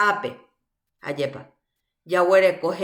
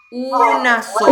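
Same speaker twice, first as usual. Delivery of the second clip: emphatic answer; 3 semitones higher, 8 dB louder than usual. Acted. Teacher cueing class answer.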